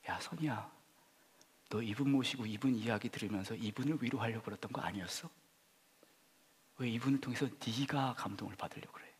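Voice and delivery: softly, near a whisper, gently rebuking; a quiet voice inside, calling him by name; hushed inner voice of God. Retold or imitated. God speaking softly in his heart, hushed rebuke.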